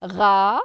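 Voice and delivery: RA loud, with a held vowel; isolated syllable. Shown as a demonstration. Teacher sounding the syllable with R, isolated syllable. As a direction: sound RA out by itself, loudly. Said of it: "le son ra".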